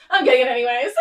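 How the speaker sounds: loud, laughing mock defiance; gleeful giving in. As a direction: blurt it out loudly through a laugh. Acts out impulsive shopper giving in.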